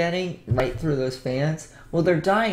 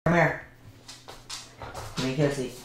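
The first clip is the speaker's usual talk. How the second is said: ordinary talk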